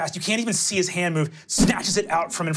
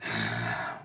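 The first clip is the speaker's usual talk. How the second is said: heavy sigh; 8 dB quieter than usual. Acted. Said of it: "he looks and goes".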